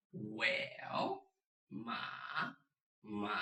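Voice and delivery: "ma" long and low, dipping then rising; third tone. Shown as a demonstration. Teacher reviews third tone, long dipping ma. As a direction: curve "ma" like a hesitant "well". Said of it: "Third tone is well".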